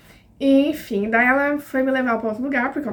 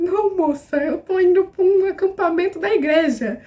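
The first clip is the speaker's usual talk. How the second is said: higher, louder, desperate insistence; exasperated pleading; 6 semitones higher, 3 dB louder than usual. Retold or imitated. Girl begging the customs officer to check her phone, exasperated pleading.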